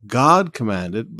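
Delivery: "God" slow and loud, each word weighed; stress on God. Shown as a demonstration. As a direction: hammer "God" slowly in "God commanded". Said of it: "now notice".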